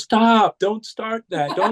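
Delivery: high, pleading "stop", imitating the wife; wife begging husband to stop the Bigfoot talk, high and scared; frightened wife. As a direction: beg him to drop it, voice jumped up high. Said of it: "she goes".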